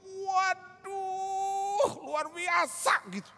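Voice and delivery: high, loud cry of wonder, slow at the end; amazed shout. Retold or imitated. Healed friend shouting to the pastor in amazement. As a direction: cry out in disbelief that the pain is gone.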